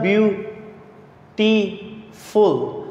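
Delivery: ब्यूटीफुल said slowly, each syllable apart, byoo-ti-ful; split into syllables. Shown as a demonstration. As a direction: spell out the three beats of ब्यूटीफुल. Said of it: "इसमें three syllables आ रहे हैं". (ब्यूटीफुल को धीरे-धीरे तीन हिस्सों में बोलो)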